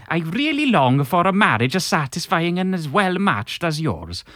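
put-on bouncy, sing-song Welsh-flavoured Italian accent. An engaged listener asking Magenta for wedding advice, in a Welsh Italian accent. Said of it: "That's a pretty good Welsh Italian".

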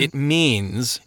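plain reading voice

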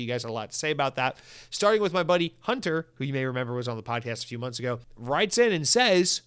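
usual speaking voice

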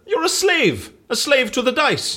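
high, scornful jeer; mocking taunt. Taunting the dice man that he is a slave, scornful jeer. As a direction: crow it at him, triumphant and scornful.

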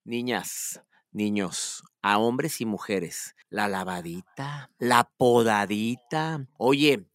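host daring a taboo tip, hushed; confiding hush. Real, not acted. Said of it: "yo lo voy a decir".

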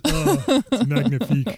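mock French exclamation, laughing; faux French. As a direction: gush it in fake French.

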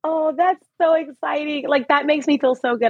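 touched and moved, voice lifting; apologetically moved by the praise; getting emotional. Real, not acted. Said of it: "I'm sorry if I'm getting emotional".

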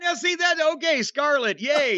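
high, playful cheer; cheering her on, a bit tongue in cheek; gleeful mock celebration. Real, not acted. Cheering Scarlett's tweet.

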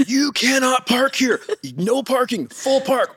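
high, slow, emphatic scolding; mock yelling. Gate guard busting the driver, high mock yell. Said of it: "yell at me and bust me".

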